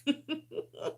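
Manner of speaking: brief soft rueful laugh; a little laugh at how life turned out; quick quiet chuckle; short laugh. Real, not acted. Laughing at her own situation.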